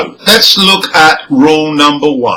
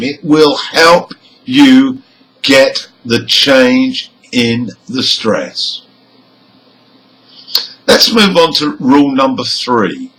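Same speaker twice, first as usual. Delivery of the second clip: ordinary talk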